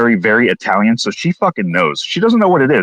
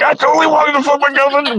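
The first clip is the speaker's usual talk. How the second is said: much higher, hammed-up whiny voice; high mock confession. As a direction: ham up a squeaky confession. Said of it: "I'm like".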